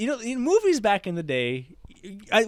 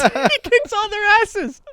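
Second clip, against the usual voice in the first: high, slow, laughing repeat; helpless laughing delight, drawn out; cracking up; 4 dB louder, slower than usual. Real, not acted. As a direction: squeal it out through laughter. Cracking up at own retelling.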